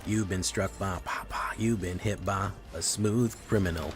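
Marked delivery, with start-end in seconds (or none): none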